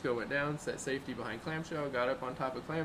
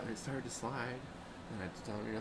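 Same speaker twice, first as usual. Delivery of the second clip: normal talking voice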